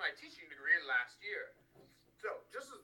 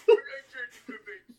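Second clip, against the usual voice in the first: put-on loud, laughing EastEnders accent; 7 semitones higher, 17 dB louder than usual. Host slipping into EastEnders accent while pleading with Harry. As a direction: bark it in Cockney, cracking up. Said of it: "turn into, like, an EastEnders accent".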